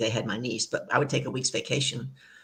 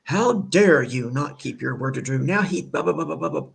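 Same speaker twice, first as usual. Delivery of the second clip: pissed-off brother; 2 semitones higher, 4 dB louder than usual. Retold or imitated. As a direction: lightly play the angry brother on the phone. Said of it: "He is pissed off at me".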